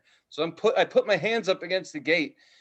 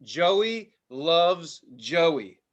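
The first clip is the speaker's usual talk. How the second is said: slow reading; 3 dB louder, much slower than usual. Retold or imitated. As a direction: read the words out very slowly. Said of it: "it says".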